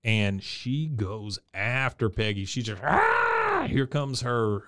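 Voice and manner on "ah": piercing high shriek; banshee scream. Retold or imitated. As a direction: let out a wild banshee scream. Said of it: "her banshee scream".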